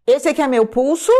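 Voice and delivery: ordinary talk, nothing marked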